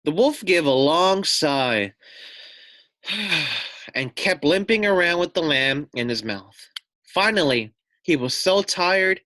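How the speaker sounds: storybook narration. A narrator's reading. Teacher reading a picture book to children, animated narration. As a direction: read it out to the children like a bedtime story.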